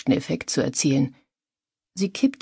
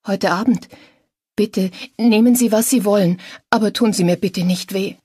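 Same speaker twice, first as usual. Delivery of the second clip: high, scared woman's voice; anxious, raised-pitch plea; frightened pleading; 6 semitones higher, 4 dB louder than usual. A narrator's reading. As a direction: beg the attacker, pitched up in fear. Terrified captive begs her attacker.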